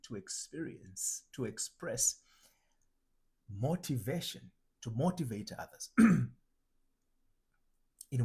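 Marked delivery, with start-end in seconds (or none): none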